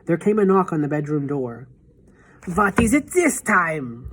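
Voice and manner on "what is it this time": slow, higher, irritated witch bark; shouted. A narrator's reading. Witch shouts at a knock on her door, annoyed. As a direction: make the interruption a nuisance. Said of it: "shouted the Grand High Witch".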